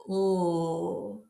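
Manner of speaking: sustained, isolated "L"; L sound. Shown as a demonstration. Teacher shows class where the tongue goes, held L sound. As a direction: hold "L" with tongue tip behind the top teeth. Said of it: "go back to making the L sound".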